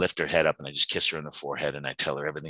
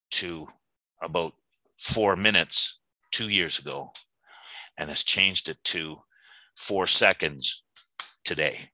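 slow emphatic; slower than usual. Real, not acted. Emphatic slowing for effect.

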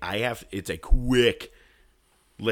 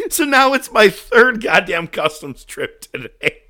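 exasperated; 11 semitones higher, 12 dB louder, slower than usual. Real, not acted. Still laughing about the customs trip.

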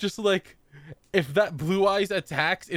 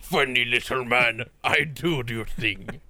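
low slow god voice; 4 semitones lower, slower than usual. Pretend. Voicing Obelisk.

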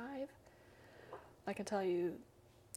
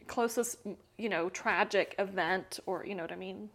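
ordinary talk

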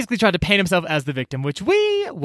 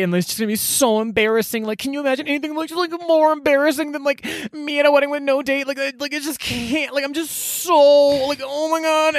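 whiny man; 11 semitones higher than usual. Retold or imitated. Drew quoted whining about no date.

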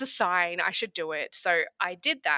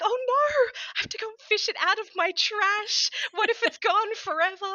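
high-pitched, louder mock panic over a deleted email; retold panic; 14 semitones higher, 4 dB louder than usual. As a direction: replay the frantic moment of realizing the email is gone. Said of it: "And then I had, was like".

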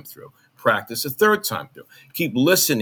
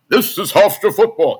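louder, higher sports announcer delivery; overdone announcer's voice; 8 semitones higher, 6 dB louder than usual. Retold or imitated. Demonstrating his practiced college football broadcast, in an announcer's voice. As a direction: boom it out like an overeager radio announcer. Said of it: "get that kind of announcer's voice going on".

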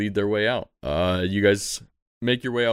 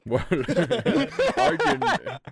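laughing; much slower than usual. Acted. Laughing, high, stretched.

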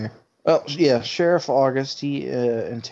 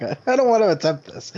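high quoted self; 8 semitones higher, 5 dB louder, faster than usual. Retold or imitated. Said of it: "That's why I was like".

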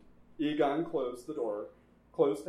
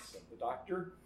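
ordinary delivery, nothing marked

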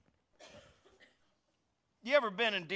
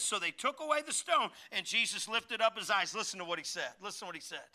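ordinary talk, nothing marked

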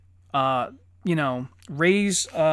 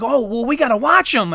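louder, higher imitated child's urging; eager little girl; 6 semitones higher, 7 dB louder than usual. Daughter wanting to watch the movies, imitated eagerness. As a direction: push it eagerly, mimicking a kid.

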